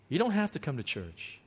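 ordinary talk, nothing marked

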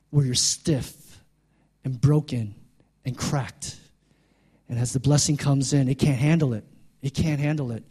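normal talking voice

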